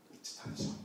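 soft unvoiced wondering; whisper. Storyteller whispering to the children in suspense. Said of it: "whispered aside to children".

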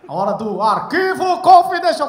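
loud, drawn-out segment announcement; mock TV host's big intro; show announcer.

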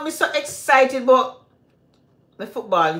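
usual speaking voice

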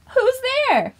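gasp, then a high surprised question; high gasping who's there. Acted. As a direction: act startled for the child. Toy reveals fox.

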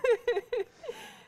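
high, bursting laugh; loud laugh. Real, not acted. Laughs at the pizza joke.

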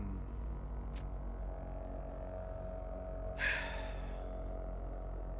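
low, drawn-out exhale; long sigh. Real, not acted. Player bracing for a long hallway, sighing. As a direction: let out a long breath.

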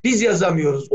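usual speaking voice

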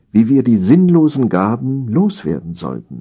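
ordinary delivery, nothing marked

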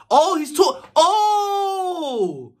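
high cry of sudden understanding; sudden realization. Real, not acted. Reacting to a lyric, suddenly getting the meaning. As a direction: it clicks mid-word, oh!.

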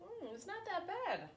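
high, surprised tasting voice. Real, not acted. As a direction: pitch way up, grudgingly pleased. Tasting the drink.

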